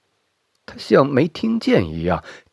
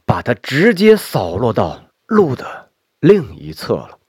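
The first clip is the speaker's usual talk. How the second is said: ordinary delivery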